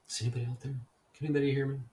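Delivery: shouting as if lost in the dark, half joking; mock calling out.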